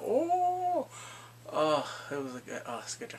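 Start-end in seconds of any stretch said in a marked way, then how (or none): 0.0-0.9 impressed oh. Retold or imitated. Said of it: "and they're like".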